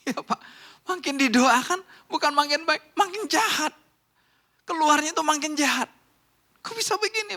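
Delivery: very high, loud incredulous complaint; exasperated congregant. Acted. Church member protesting to the pastor about a husband, exasperated.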